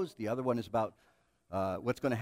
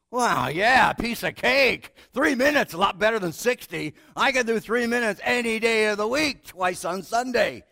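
louder, higher, boastful; cocky eagerness; 6 semitones higher, 8 dB louder, slower than usual. Retold or imitated. Preacher boasting to the Lord about three minutes, loud and cocky. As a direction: brag like it's nothing. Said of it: "And I go".

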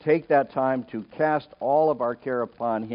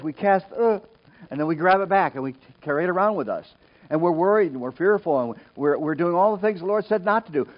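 ordinary talk, nothing marked

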